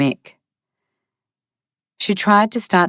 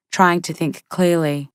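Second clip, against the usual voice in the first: plain reading voice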